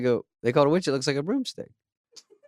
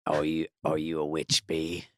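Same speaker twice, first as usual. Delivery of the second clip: much lower, slow tough-guy voice; beekeeper voice; 8 semitones lower, 7 dB quieter, slower than usual. Acted. Host voicing the beekeeper meeting the bee, low slow action-hero voice. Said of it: "oh, the beekeeper".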